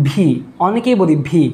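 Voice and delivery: "ভি" with a breathy Bengali bh, lips closed; wrong V sound. Shown as a demonstration. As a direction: say "ভি" as bhee, like a B. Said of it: "So ভি হবে না". ("ভি" ঠোঁট চেপে, ভুল ভ ধ্বনিতে)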